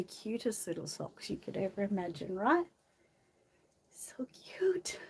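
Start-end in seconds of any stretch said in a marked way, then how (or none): 3.9-5.0 breathy whisper, rising at the end. Real, not acted. Mother whispering over the girl's cute socks. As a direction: hush it like a secret delight.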